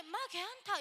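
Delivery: pastor's Gyeongsang dialect, mock comfort. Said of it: "경상도 사투리를 너무 심하게".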